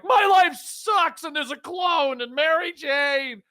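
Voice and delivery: high, slow, whiny complaint; mock whine. Host parodying Spider-Man's woes, whiny. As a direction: drag out a self-pitying moan.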